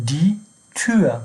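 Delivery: دی تور slowly, with Ü in تور; slow German model. Shown as a demonstration. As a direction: model دی تور word by word, rounding تور to "Tür". Teacher models German umlaut example.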